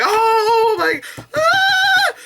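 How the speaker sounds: cringing shriek; exasperated scream. Retold or imitated. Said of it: "I was like".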